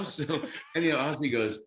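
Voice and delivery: usual speaking voice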